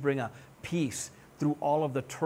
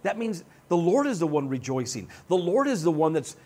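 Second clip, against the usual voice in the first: ordinary talk, nothing marked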